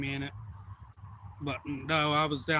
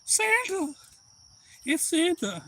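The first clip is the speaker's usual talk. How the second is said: very high imitated little-kid squeal; tiny high voice, thrilled at Santa; excited child; slower than usual. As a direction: squeak it in mock wonder. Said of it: "The other one was like".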